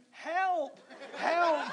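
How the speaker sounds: high, loud cry for help; hammed-up scream for rescue; retold scream. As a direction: shout out in mock terror. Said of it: "I screamed".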